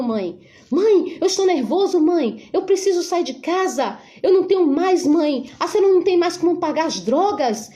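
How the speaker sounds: high, urgent pleading to his mother; panicked son. Retold or imitated. Son pleading with his mother after the shooting, high and panicked. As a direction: blurt it out, scared and begging.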